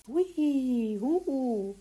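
high, loud spooky hui huhu; mock ghost wail; joking ghost hoot. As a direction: tease with a ghost noise. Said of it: "scherzte Zwitschi".

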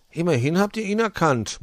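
usual speaking voice

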